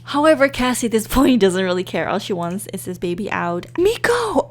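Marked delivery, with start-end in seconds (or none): 3.8-4.5 high, loud, delighted call of the name; excited cry. Real, not acted. Gamer sees the friend arrive to help, squealing.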